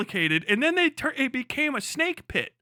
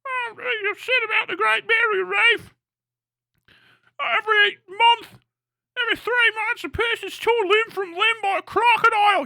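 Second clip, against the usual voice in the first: high, shrill-sounding mock complaint, pushed up and loud; mock Australian whine; 10 semitones higher, 4 dB louder than usual. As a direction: whine it like a scandalized Aussie.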